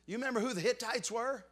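loud, high preaching cry; fiery pulpit delivery; full-force preaching. Acted. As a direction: preach it out to the back pews.

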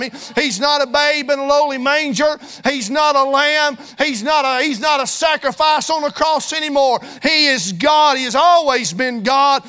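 loud, driving preacher's cadence; fiery preaching. Acted. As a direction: preach it hard to the congregation. Preaching at full force.

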